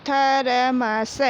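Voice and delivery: Thermos drawn out slowly as थ-र-म-स; slow syllable reading. Shown as a demonstration. Teacher sounding out thermos for pupils, slowly. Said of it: "पूरा इसका उच्चारण कैसे".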